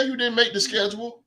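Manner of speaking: ordinary talk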